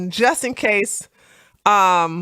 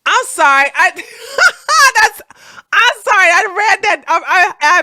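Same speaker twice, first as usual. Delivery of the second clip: laughing; 10 semitones higher, 8 dB louder than usual. Real, not acted. Laughing apology.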